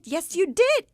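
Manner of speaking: re-enacted high, loud agreement; excited confirmation. Retold excited confirmation of recognition.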